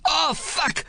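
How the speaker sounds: sudden high cry of pain; wounded Maori yells as his leg moves, sharp. Acted. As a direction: blurt it as the leg shifts. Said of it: "he yells".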